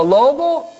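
high, mild mock cry; grito de alarma. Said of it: "él gritaba". (grito agudo moderado)

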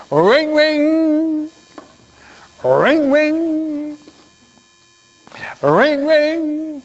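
high, sustained ringing tone; phone ringing imitation. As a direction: imitate a telephone, holding the ng. Imitates a telephone in an example. (timbre de teléfono, agudo y sostenido)

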